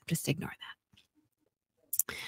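soft whispered aside. Real, not acted. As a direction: whisper it under your breath.

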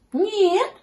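high kitten voice; high-pitched reassuring kitten; Гав. A narrator's reading. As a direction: answer as a piping little kitten. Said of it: "сказал котёнок Гав".

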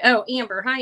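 ordinary talk, nothing marked

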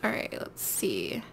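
whispered to herself; soft whisper to self. Real, not acted. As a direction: breathe it under your breath. Muttering to self while searching.

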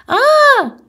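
sharp high yelp of pain; sudden cry. A narrator's reading. Boy trips on a marble and falls, sharp high yelp. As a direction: cry out as you trip and fall. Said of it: "φωνάζει ξαφνικά".

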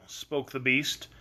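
ordinary delivery, nothing marked

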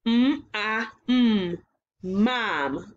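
segmented sound by sound, then blended; mm, ah, mm, then mom; sound blending. Shown as a demonstration. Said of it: "let's blend these sounds together".

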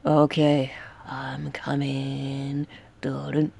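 slow, soft whisper, no voice; whispered stealth approach; sneaky whisper. Real, not acted. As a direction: creep in quietly. Sneaking up on the killer.

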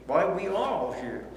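usual speaking voice

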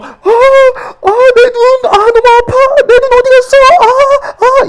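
hammed-up shriek of pain; mock pained screaming. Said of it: "막 아파갖고".

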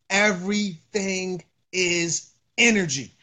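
each word set apart; drawn-out, deliberate delivery with gaps; slow emphatic repeat. Real, not acted. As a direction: spell it out slowly, word by word. Repeats point slowly for emphasis.

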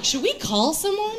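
high, worried partygoer voice; mock concern. Party guests asking about comic's boyfriend, mock worried.

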